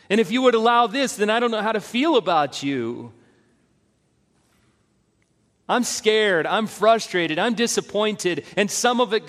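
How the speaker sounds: pleading honestly with God; anguished prayer voice; raw lament. Acted.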